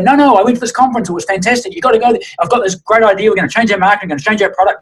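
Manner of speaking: high, gushing, rushed enthusiasm; mock hyped-up staffer babbling about the conference; overexcited. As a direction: play the wide-eyed convert bursting with ideas. Mimicking an overexcited conference-goer.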